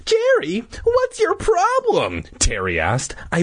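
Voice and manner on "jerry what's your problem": high sister voice. Acted.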